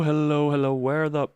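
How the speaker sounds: ordinary talk, nothing marked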